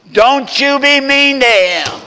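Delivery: exaggerated high, loud wifely scold; mock scolding wife. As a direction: ham up the nagging wife's caution. Wife warning him, hammed up.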